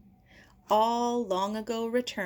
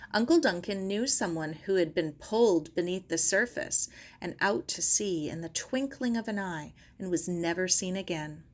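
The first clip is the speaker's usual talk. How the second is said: ordinary delivery, nothing marked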